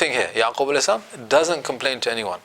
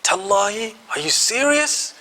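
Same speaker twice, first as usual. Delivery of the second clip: much higher, slower, stunned "Tallahi, are you serious?"; shocked disbelief; 8 semitones higher than usual. Shown as a demonstration. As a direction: gasp it out like you can't believe it. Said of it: "It's like shock".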